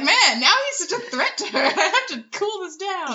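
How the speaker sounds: laughing, quick mock panic; half-laughing fake worry; mock alarm. Retold own anxious thought.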